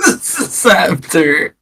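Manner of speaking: laughing through the words; can barely get it out for laughing. Real, not acted. Laughing in disbelief at clip.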